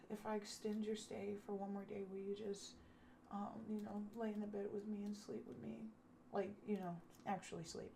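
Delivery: very soft, tentative asking; timid request. Retold or imitated. Man offering to pay for another night, timid request. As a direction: imitate a shy plea, barely projected.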